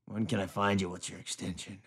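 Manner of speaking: very low, hushed creepy murmur; sleazy phone caller. Acted. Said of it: "the dude's like".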